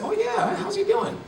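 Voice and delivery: light up at the news; surprised and glad on the phone; delighted surprise. Retold or imitated. Retelling his reaction on phone.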